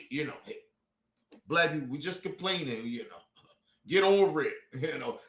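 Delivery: softly mocking their brush-off; muttered mock quote. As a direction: mimic them under your breath. Dismissive voice of others mocked.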